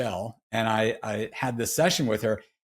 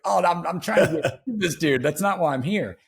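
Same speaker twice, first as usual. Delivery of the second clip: mildly exasperated, higher-pitched pushback; retold exasperated protest; 6 semitones higher, 3 dB louder than usual. Client objecting to the voice in his head, mock exasperated protest. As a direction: imitate your own flustered objection.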